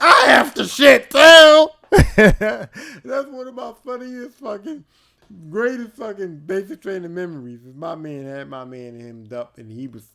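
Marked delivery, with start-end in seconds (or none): 0.0-1.7 very loud, high, slow wailing plea; desperate whine. Retold or imitated. Scarborough begging Ramin for the toilet, loud slow wail. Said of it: "Scarborough was like". 1.8-7.6 slow, loud, laughing through the words. Real, not acted. Comedian cracking up at his own memory, laughing.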